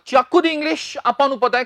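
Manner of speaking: usual speaking voice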